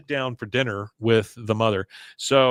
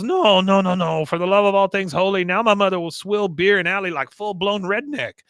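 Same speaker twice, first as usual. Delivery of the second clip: high, loud mock lament; appalled outburst; 6 semitones higher, 5 dB louder than usual. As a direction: protest in exasperated horror, pitched up. Con appalled by mother drinking beer.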